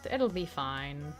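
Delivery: ordinary delivery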